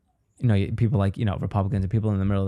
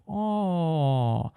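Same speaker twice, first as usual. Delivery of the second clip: one long "oh", pitched up, as mock Chinese; mock Chinese accent; 4 semitones higher, 3 dB quieter than usual. Comedian showing one noise gives it away, mock Chinese accent. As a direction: stretch a caricatured Chinese-accent "oh". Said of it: "a Chinese accent is so stereotypical".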